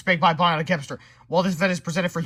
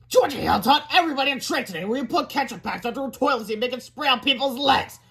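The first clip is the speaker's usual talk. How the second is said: tattling proudly; 9 semitones higher than usual. Acted. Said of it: "reported proudly".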